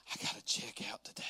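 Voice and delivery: unvoiced, dreading checkout; mock whisper.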